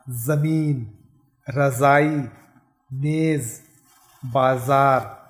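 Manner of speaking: "जमीन" with a z sound; each word with a clear z, not j; nukta ज़ demo. Shown as a demonstration. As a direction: buzz the z in "बाजार". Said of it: "ज के नीचे बिंदी लगने से ये हो गया ज".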